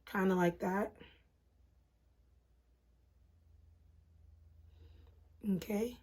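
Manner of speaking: hushed, breath held while concentrating; quiet, barely breathing; holding breath. Real, not acted. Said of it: "I hold my breath and get quiet".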